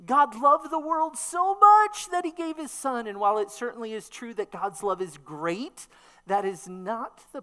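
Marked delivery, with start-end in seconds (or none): 0.1-3.1 much higher and louder, gushing on much; emphatic degree reading. Shown as a demonstration. Preacher acting out the misreading, high and loud. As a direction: belt out how much he loved. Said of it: "That's how we understand those things".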